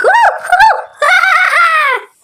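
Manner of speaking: high, whooping laughter; loud gleeful laugh. Acted. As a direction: laugh out in triumph. Celebrating getting rich.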